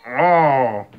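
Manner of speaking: ordinary talk, nothing marked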